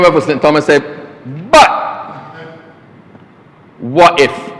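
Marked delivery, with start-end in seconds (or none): 1.5-4.5 a long dramatic pause after "But", then "what if?". Retold or imitated. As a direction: hold the beat after "But" before landing "what if?". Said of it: "a dramatic pause".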